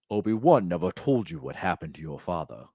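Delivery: low, lightly voiced menacing Vader; a hint of a villain revealing a secret; mock Darth Vader. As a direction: intone it like the dark lord. Acting out Vader scene.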